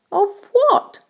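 put-on high-pitched boy's question. A narrator's reading. James interrupts the ladybird, high questioning voice. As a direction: ask it in a high voice. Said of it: "asked James".

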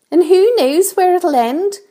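high, loud character grumble; grumbling earthworm. A narrator's reading. Earthworm doubts the journey, high grumbling voice. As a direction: complain in a high-pitched voice. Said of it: "muttered the earthworm".

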